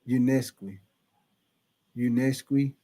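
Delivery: Unesqui said low and soft, as you-NES-kwee; careful pronunciation practice. Shown as a demonstration. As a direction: try out Unesqui quietly, low in the voice. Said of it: "You say it's pronounced".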